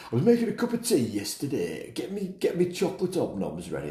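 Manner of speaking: high-pitched, hammed-up classic English accent; put-on English accent. Podcaster mocking a classic English tea remark, put-on English accent. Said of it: "I don't know why I'm putting that accent on".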